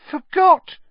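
very high, loud shocked echo; weak disbelief. A narrator's reading. Stan echoing Harry's excuse, shocked and weak. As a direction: squeak it back in feeble disbelief. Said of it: "said Stan weakly".

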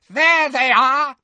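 high, loud, slow shriek. A narrator's reading. Bellatrix shrieks at the fleeing kids, high and loud. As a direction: shriek it across the hall. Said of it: "she shrieked".

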